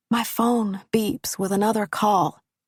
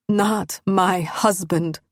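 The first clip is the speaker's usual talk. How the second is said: anguished denial; slower than usual. Acted. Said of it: "bubbles up from the very core".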